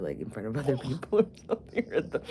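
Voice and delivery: ordinary talk, nothing marked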